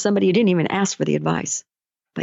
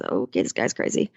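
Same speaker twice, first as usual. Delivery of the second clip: much lower and softer under-the-breath remark; muttered aside; 11 semitones lower, 5 dB quieter than usual. Retold or imitated. Coach voicing the rescuer muttering that the man is crazy. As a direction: mutter it to yourself as you leave.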